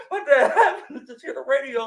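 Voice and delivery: high, loud laughter through words; cracking up mid-story; laughing. Real, not acted.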